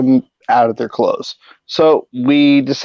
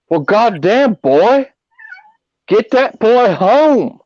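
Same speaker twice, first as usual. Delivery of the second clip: put-on higher Texas cop voice with a slowed get that boy home; 5 semitones higher than usual. Texas state trooper sending the boys home, higher Southern twang. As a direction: lay on a Southern lawman twang.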